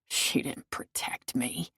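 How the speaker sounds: slow, breathy hush; hushed bitter murmur. A narrator's reading.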